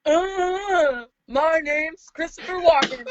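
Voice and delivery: lightly voiced Christopher Walken; a hint of Walken's stilted delivery; stiff awkward speech. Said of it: "Okay, here's mine".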